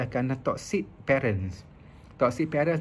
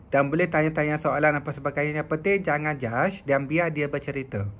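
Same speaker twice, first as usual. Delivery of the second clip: normal talking voice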